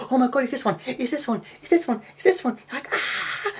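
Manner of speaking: retold kid's excitement, pitch rising with each question; excited. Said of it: "you're so bloody excited".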